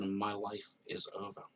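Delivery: normal talking voice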